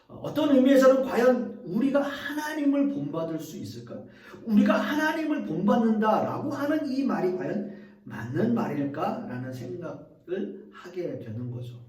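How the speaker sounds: ordinary delivery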